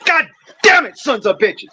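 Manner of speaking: sudden high yelled curse; furious outburst. Real, not acted. Veteran cursing Congress over cut veterans' benefits, furious yell. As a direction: burst out cursing, then drop away.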